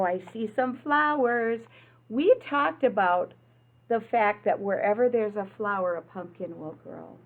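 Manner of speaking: ordinary delivery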